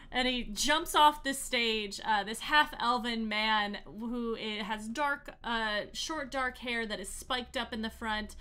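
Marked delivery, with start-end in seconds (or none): none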